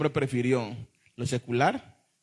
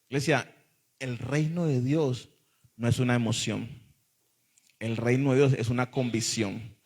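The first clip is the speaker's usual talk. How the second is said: ordinary talk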